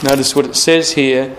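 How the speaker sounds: ordinary talk, nothing marked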